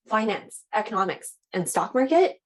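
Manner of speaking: key terms lifted, ending high on "stock market"; voice going up. Shown as a demonstration. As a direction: raise the voice on "finance", "economics" and "stock market". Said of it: "have your voice go up a bit".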